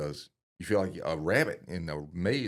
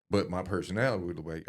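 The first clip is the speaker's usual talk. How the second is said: normal talking voice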